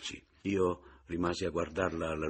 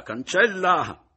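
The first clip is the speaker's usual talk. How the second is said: higher, louder and slow, cancella cried out; loud urging shout; 11 semitones higher, 12 dB louder, slower than usual. A narrator's reading. Mattia, shouting at Romilda to wipe the kiss away. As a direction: call it out at full voice.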